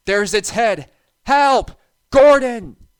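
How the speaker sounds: ordinary delivery, nothing marked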